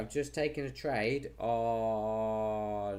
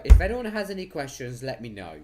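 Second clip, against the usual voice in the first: calling out; 13 dB louder, faster than usual. Real, not acted. Calling out to stream viewers.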